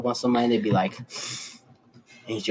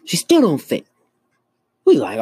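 loud exasperated burst; 9 semitones higher, 6 dB louder than usual. Real, not acted. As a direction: blurt it loud, fed up. Exasperated punchline.

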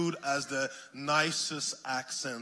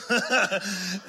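laughing burst; 7 semitones higher, 8 dB louder than usual. Real, not acted. Laughs at own joke.

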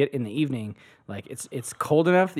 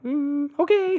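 dubious; 12 semitones higher, much slower than usual. Retold or imitated. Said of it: "I'm like".

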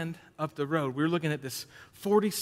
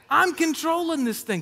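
mock confident boast; 10 semitones higher, 6 dB louder than usual. Mock self-talk while arguing.

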